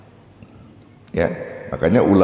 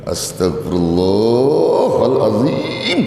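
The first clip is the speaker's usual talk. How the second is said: slow exasperated Astagfirullahaladzim through gritted teeth; istighfar through gritted teeth; much slower than usual. Shown as a demonstration. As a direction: sigh it out in irritation. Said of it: "sambil gigit giginya itu". (istighfar kesal sambil gigit gigi)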